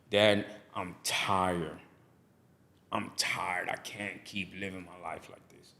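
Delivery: weary plea. Retold or imitated. Telling dad he's exhausted.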